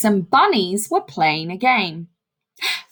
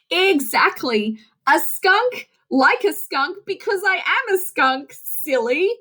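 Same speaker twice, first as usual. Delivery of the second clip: playful skunk; 7 semitones higher than usual. Acted. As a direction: tell the deer the obvious, teasing.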